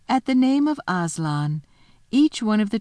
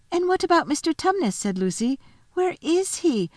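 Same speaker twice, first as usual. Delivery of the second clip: lightly voiced little girl, delighted; 9 semitones higher than usual. Narrator voices Lucy.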